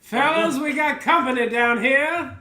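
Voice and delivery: calling out. Acted. Character calling to party below.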